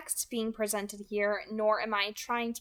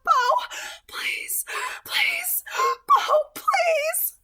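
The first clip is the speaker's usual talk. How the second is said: much higher and louder, a shriek through tears; crying shriek; 9 dB louder, much slower than usual. Acted. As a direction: scream for help, sobbing. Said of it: "Edith shrieked".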